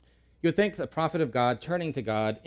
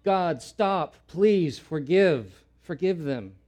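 higher, slow heartfelt begging; earnest plea; 4 semitones higher, 5 dB louder, much slower than usual. Acted. Amos pleading for the people he cares for, heartfelt plea. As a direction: plead with care for your people. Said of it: "He cared".

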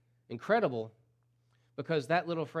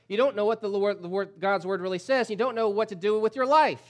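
loud, high, driving preacher's cry; a pastor raising his voice over the congregation; preaching at full force; 7 semitones higher, 8 dB louder than usual. Acted. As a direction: hammer it home to the pews.

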